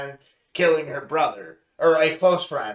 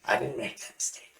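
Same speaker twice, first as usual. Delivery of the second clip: smug whisper; 4 semitones lower, 8 dB quieter than usual. Acted. Smug aside before shouting.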